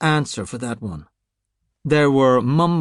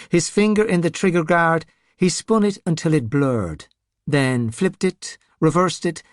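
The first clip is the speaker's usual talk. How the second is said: usual speaking voice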